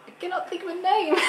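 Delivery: high-pitched exasperated wail. Real, not acted. Blanking on an actress's name, exasperated high wail. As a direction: cry out at your own mental block.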